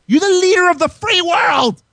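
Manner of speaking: exaggerated mock outrage at the anchor; mocking repeat. Shown as a demonstration. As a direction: throw it back at him in a shrill mocking tone. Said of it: "repeated mockingly".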